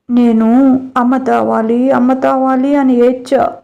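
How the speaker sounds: put-on baby talk with a pouting whimper, drawn out; cute toddler voice. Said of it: "ముద్దు ముద్దుగా".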